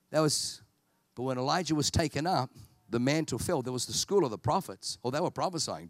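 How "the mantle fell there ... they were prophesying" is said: usual speaking voice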